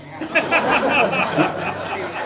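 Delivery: high burst of real laughter. Real, not acted. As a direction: break up laughing at the absurd excuse. Laughs at suspect's excuse.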